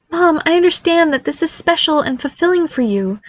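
high-pitched, sighing objection; exasperated son. A narrator's reading. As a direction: patiently reason with Mom, pitched high. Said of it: "he gave a wispy sigh".

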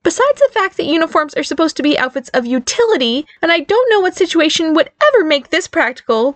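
high-pitched, indignant rant. A narrator's reading. Barry argues with mother over fairy outfits, ranting. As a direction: rattle off the complaint indignantly. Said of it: "Barry ranted".

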